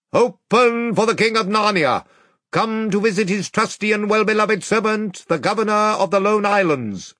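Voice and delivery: high, ringing proclamation; herald's cry. A narrator's reading. Caspian's trumpeter announcing the king at the castle gate, herald's cry. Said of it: "blew a blast and cried".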